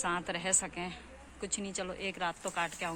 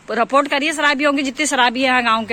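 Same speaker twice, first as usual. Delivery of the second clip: louder, higher teasing reproach; loud teasing scold; 5 semitones higher, 16 dB louder than usual. Real, not acted. Teasing the shopkeeper about drunkards, loud and high.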